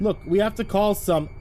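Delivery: high, insistent appeal; urgent plea. Acted. Driver urging passenger to call someone, cut off mid-sentence, agitated. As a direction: plead urgently, pitch lifted.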